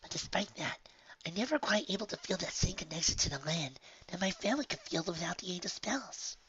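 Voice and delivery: soft breathy whisper, high; shy young filly, hushed; whispery filly voice. Acted. Honeydew character whisper voice.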